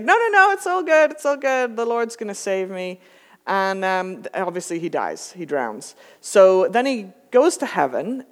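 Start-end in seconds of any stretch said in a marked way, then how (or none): 0.0-3.0 hammed-up pious pastor. Pastor on the steeple refusing the helicopter, high and hammed-up. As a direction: ham up the blissfully oblivious preacher. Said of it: "And he's like".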